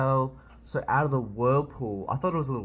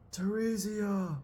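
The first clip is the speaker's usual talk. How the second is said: anguished cry; 10 semitones higher, slower than usual. Real, not acted. Reacting as the Count is dragged away.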